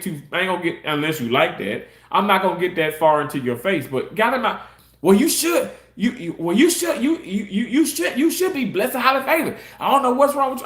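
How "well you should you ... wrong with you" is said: high, huffy, stammering scold; coworker with attitude. Retold or imitated. Coworker scolds him for not being blessed, huffy and much higher. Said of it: "got an attitude".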